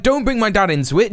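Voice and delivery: usual speaking voice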